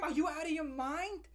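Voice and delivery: usual speaking voice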